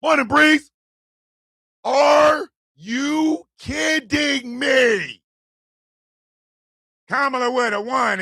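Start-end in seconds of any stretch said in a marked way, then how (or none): 1.8-5.2 slow, stretched, loud disbelief; drawn-out incredulous shout. Acted. Reacting to the Rogan claim with a slow incredulous shout. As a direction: drag it out in outrage.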